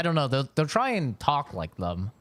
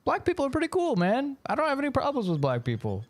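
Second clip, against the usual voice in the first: much higher, naive voice, quicker at the end; naive younger self; 12 semitones higher, faster than usual. Retold or imitated. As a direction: play your wide-eyed younger self. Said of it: "that's when I was like".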